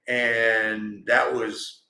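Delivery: normal talking voice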